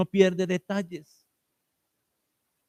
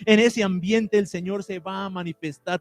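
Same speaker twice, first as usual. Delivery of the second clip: louder, rising preacher's build; fervent preaching; 4 dB louder than usual. Acted. Pastor rousing the church to seek the Spirit, fervent preaching. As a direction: preach it with growing fire to the church.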